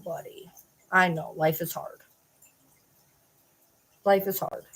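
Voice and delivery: low, soft comforting voice; soothing pet talk. Real, not acted. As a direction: comfort the cat gently. Soothing the upset cat.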